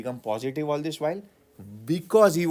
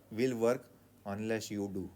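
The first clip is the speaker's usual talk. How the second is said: ordinary talk, nothing marked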